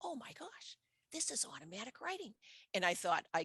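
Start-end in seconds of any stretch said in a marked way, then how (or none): 0.0-2.3 high, hushed oh my gosh of wonder; a sudden breathless discovery, pitched up and softer; imitating her own hushed astonishment; amazed realization. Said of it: "And I'm like".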